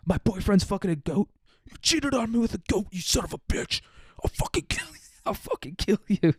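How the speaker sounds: high shrill hammed-up jealous fury; hammed-up betrayed boyfriend rant; mock jealous rage. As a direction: play a scorned lover losing it. Moses finds his cheating boyfriend.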